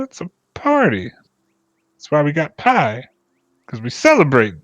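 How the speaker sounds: high, insistent scolding; wary villager. Acted. Villager warily telling the warforged it is only a party. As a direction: correct him, it's a party.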